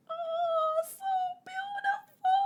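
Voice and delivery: high, tearful awe; moved to tears. Real, not acted. Reader tearing up over Bonnie, high tearful awe. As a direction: choke up in wonder.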